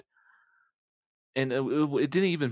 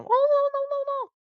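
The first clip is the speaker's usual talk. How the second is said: very high-pitched nonsense syllables; mock-language babble; 4 dB louder than usual. That guy going off at the owner, babbling. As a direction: play a customer babbling away in gibberish. Imitates customer's unknown language again.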